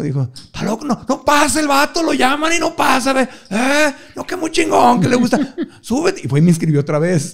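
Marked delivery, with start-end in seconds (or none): 0.3-6.2 high, laughing buddy voice; mock friend, high and laughing; imitating a rowdy friend. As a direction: egg him on with a laugh. Friend shouting him onstage, laughing.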